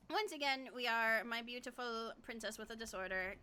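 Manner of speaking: ordinary delivery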